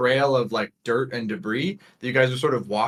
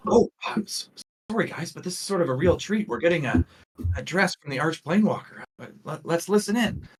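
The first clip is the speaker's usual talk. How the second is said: high, eager guide voice; Juliet; 8 semitones higher than usual. Acted. Guide inviting visitors to hear the leader, eager. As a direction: let them in on a treat, pitched up. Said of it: "as Juliet goes".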